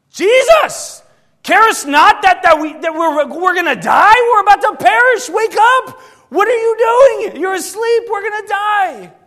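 high, loud terrified yelling of the disciples; panicked shout. Acted. Disciples wake Jesus in the storm, shouting in panic. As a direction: scream it like men sure they'll drown.

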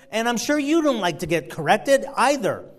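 ordinary talk, nothing marked

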